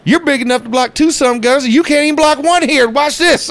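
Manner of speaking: Spurrier imitation. Coach mocking a freshman's missed block in film, high voiced. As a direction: needle the freshman in front of the team. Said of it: "he's like".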